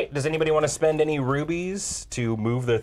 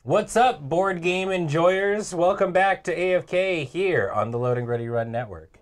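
host intro; 4 semitones higher than usual. Acted. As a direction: greet viewers like a TV host. Host welcome back after break.

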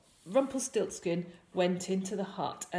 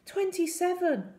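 put-on boy's reply; 11 semitones higher, 3 dB louder than usual. A narrator's reading. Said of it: "the boy said".

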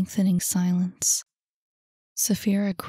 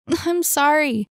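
high, slow, pleading exclamation; exclaimed; 7 semitones higher, 4 dB louder than usual. A narrator's reading. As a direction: blurt an apology, pitched high. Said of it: "exclaimed Annie".

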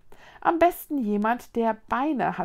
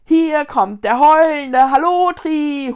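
slow, high, boastful holler; full-throated yell; 6 semitones higher, 14 dB louder, slower than usual. A narrator's reading. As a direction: announce yourself at a wild shout. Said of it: "schrie Linus aus vollem Hals". (übermütig laut schreiend)

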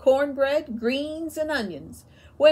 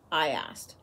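usual speaking voice

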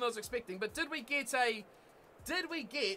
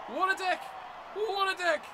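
exasperated; 8 semitones higher than usual. Real, not acted. Insults computer player.

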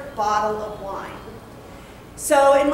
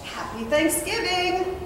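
slow, softer, faking freshness; un-hungover voice; 6 semitones higher, 5 dB quieter, slower than usual. Retold or imitated. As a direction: greet the boss as if you weren't hungover. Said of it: "in my most un-hungover voice".

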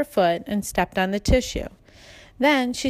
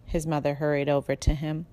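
ordinary delivery

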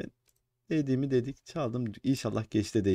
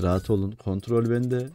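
ordinary talk, nothing marked

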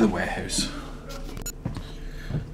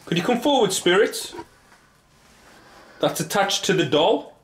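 high, raised questioning call; calling out to spirits; 9 semitones higher than usual. Real, not acted. Investigator asks if anyone is here, calling out. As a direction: call into an empty building, pitched well up.

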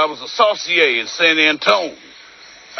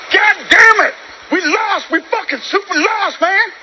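angry shout. Acted. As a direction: bellow it out in frustration. Lost in the jungle, cursing.

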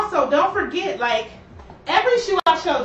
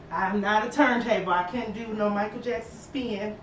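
ordinary delivery

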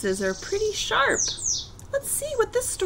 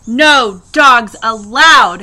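very loud, slow ban; yelling; 13 dB louder, much slower than usual. A narrator's reading. Hank barring the coyote from passing, yelling. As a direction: yell it word by word. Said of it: "Hank yelled".

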